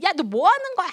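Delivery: high sharp scolding yell; angry mother. Acted. Mother scolding the child again, high and sharp. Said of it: "또 아이를 또 혼내고".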